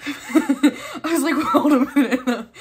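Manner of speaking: loud laughing through the words; bursting out laughing. Real, not acted. Collector misread something, bursting out laughing. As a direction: crack up mid-sentence, voice climbing.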